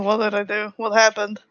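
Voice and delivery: ordinary delivery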